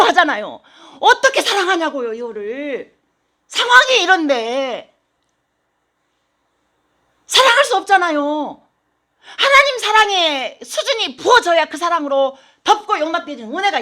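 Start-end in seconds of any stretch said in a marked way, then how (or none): none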